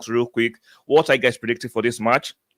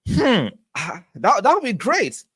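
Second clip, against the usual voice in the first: high, delighted lift on would be great; light up at the thought of a Kai hat-trick; excited; 10 semitones higher than usual. Real, not acted. Streamer reading a chat prediction of a Kai hat-trick, high and delighted.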